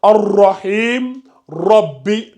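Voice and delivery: slow Ar-rahim with a full, heavy r; Ar-rahim, robbi with tafkhim; emphatic ra. Shown as a demonstration. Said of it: "On augmente de volume".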